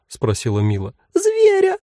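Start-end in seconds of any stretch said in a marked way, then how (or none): 1.1-1.8 terrified tiny shriek. Acted. Cloud cries in terror.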